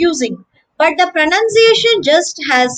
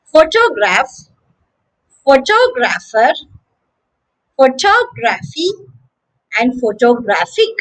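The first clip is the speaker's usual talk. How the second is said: PHO-tograph, pho-TOG-rapher, pho-TOG-raphy, photo-GRAPH-ic; careful list from PHO-tograph to photo-GRAPH-ic; shifting word stress. Shown as a demonstration. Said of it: "So one last time".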